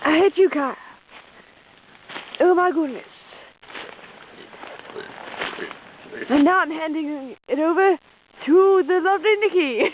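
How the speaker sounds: goofy put-on Australian voice; hammed-up Aussie explorer accent; mock Australian.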